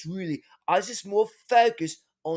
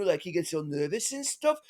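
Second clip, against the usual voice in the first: normal talking voice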